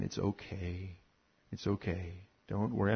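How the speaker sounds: put-on calm a frightened child on your lap; mother rocking her scared son in a chair; soothing mother. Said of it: "she just began to quiet me".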